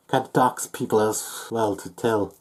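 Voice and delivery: ordinary delivery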